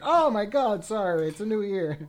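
high, loud flustered apology; startled apology. Retold or imitated. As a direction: catch yourself, startled. Retold date mix-up.

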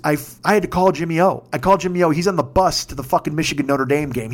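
ordinary talk

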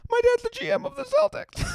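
very high whiny sob; mock crying. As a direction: whine it through fake tears. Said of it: "And I'd be like".

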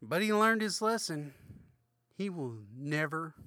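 hammed-up sermon close, calling for hallelujah; mock preacher. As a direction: preach the lesson to the crowd. Preacher-style closing, hallelujah.

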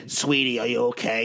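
low, lightly voiced tough-talking woman; nasty Dice Clay-type voice. Nice-looking woman comforting him, in a nasty voice. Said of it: "that nasty, sounds like Andrew Dice Clay type of voice".

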